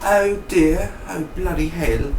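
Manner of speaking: high, lightly British Gemma voice; a lightly done British lament; mock British Gemma.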